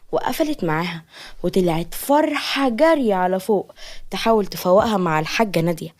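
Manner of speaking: usual speaking voice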